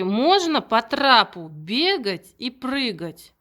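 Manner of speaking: put-on slow, drawn-out question to a child; slow child-directed question. Shown as a demonstration. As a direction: stretch it out for a little one. Teacher quizzes a young child.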